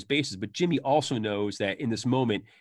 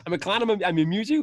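a hint of menacing Tommy, higher; Tommy quote; 7 semitones higher, 4 dB louder than usual. Quoting Tommy's clown line from the movie, lightly menacing. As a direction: lightly voice the famous threat. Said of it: "Funny, funny how scene".

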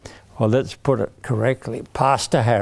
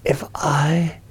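whispered prompt. Acted. Prompting children as Hannah.

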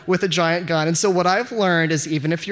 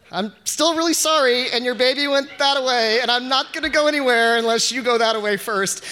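raised pleading to bear; 4 semitones higher than usual. Retold or imitated. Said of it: "pleading with the bear".

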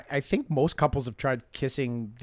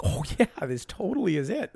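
excited realization; 8 semitones higher, 5 dB louder than usual. Retold or imitated. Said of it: "and I'm like".

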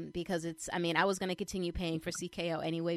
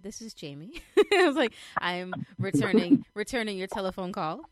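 put-on phone voice; 3 semitones higher, 8 dB louder than usual. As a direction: answer the phone all formal and proper. Mimics own formal phone answer.

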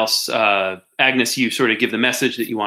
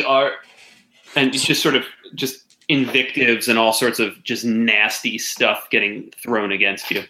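ordinary delivery

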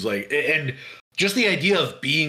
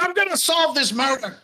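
put-on high, determined Brooklyn cop accent; 11 semitones higher than usual. Detective answering his partner at home in a Brooklyn accent. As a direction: promise it like an obsessed Brooklyn detective. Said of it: "I think I was doing kind of maybe Brooklyn".

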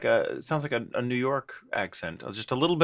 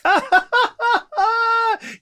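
high, loud shriek of laughter; 8 dB louder than usual. Real, not acted. Co-host bursts out at the host's self-censoring, shrieking laugh. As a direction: crack up in a high squeal.

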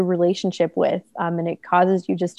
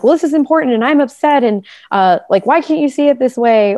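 imitating an upset, pleading partner, higher and louder; mock a hurt protest, pitched up and pushed; higher, louder mock complaint of being upset; upset plea; 6 semitones higher, 4 dB louder than usual.

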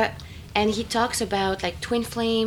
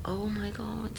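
breathless whisper; whispered realization; 12 dB quieter than usual. Retold or imitated. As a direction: whisper it in awe. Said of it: "And then I was like".

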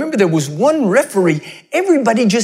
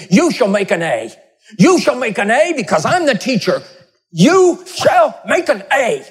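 psychotic professor; 2 semitones higher than usual. Acted. Professor to students on first day, menacing command. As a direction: order it like a tyrant teacher, harder each time. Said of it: "a professor who's not a psychotic".

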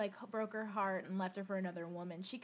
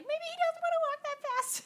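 much higher, louder skeptical quip; mock worried aside; 6 dB louder than usual. Joking about her own thought during the metro scene, high mock-worried quip. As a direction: squeak out your doubt about the blind man. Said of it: "which I was like".

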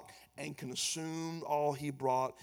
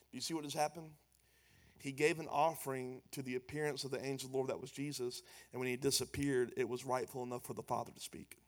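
ordinary talk

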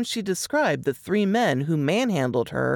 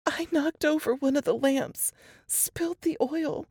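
higher, strained voice of a frightened woman; Laura reliving the horror, voice pitched up; shaken young woman; 10 semitones higher, 3 dB quieter than usual. A narrator's reading. Laura telling Jess and Nate her ordeal, higher and shaken.

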